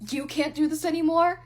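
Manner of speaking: mocking disbelief. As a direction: throw the words back at her in scorn. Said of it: "he scoffs at me".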